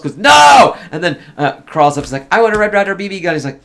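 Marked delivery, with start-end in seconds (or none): 0.2-0.8 long, high shouted "no"; desperate no. Retold or imitated. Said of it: "the way Ralphie says no". 2.3-3.3 put-on fast, high-pitched kid's blurt; blurted wish. Retold kid blurting out his wish.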